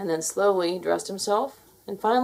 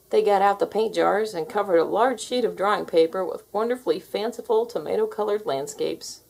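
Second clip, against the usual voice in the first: plain reading voice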